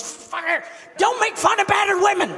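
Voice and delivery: angry woman yelling a warning at the comedian; angry shout. Retold or imitated. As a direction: bark the warning at him, hammed up. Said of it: "she goes".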